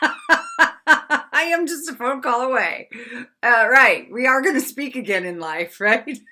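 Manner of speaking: hammed-up needy mother, loud and laughing; loud, high mock-desperate reassurance; acted frantic mess. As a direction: plead it loudly, half laughing at yourself. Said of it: "how much of a mess I was".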